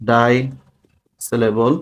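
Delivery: word by word with long pauses; dictation. Real, not acted. Teacher giving students terms to write down, dictating.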